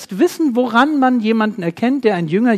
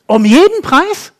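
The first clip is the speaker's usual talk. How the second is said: louder and higher, incredulous questions; indignant disbelief; 5 semitones higher, 3 dB louder than usual. Pretend. Preacher voicing an indignant churchgoer, loud incredulous protest. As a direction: protest like someone told to give up his rights. Preacher voices outraged listener's objection.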